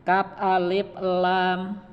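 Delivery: slow, clear "Kap alif lam"; teacher modelling "Kap alif lam"; slow spelling drill. Shown as a demonstration. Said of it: "Saya dulu, Pak, ya".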